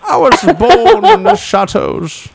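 high, slow mock Bane with a laugh. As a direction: ham up a Bane impression. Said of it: "He sounds like Bane".

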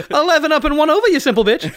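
Mulaney doing a Def Jam comic; John Mulaney Def Jam voice. Pretend. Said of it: "This is John Mulaney Def Jam voice".

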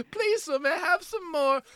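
very high, whimpering English urchin; mock tearful English begging; Oliver Twist. Bad actor drifting into orphan role, high hammed-up plea. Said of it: "Oliver Twist".

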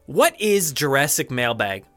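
ordinary delivery

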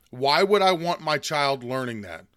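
ordinary talk, nothing marked

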